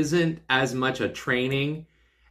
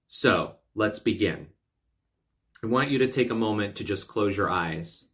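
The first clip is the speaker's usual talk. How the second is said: guided meditation; 3 semitones lower than usual. Acted. Coach asking listeners to close their eyes, guided meditation voice. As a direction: ease them in gently with long pauses.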